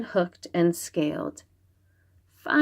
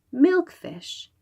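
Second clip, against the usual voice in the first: ordinary delivery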